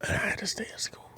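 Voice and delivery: whispered, quick and hushed. Retold or imitated. After seeing his hair, hushed whisper. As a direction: breathe it out in dismay.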